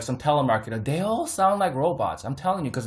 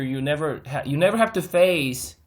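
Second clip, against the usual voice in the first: ordinary delivery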